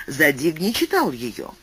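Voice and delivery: plain reading voice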